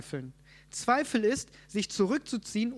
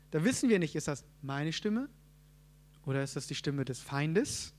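usual speaking voice

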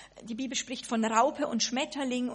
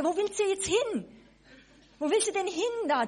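teasing high question; 8 semitones higher, 3 dB louder than usual. Acted. Addressing a wandering butterfly.

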